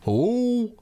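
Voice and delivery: rising to a high, loud second hello; stepdad answering phone. Retold or imitated. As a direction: mimic the stepdad picking up, clueless. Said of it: "he'd be like".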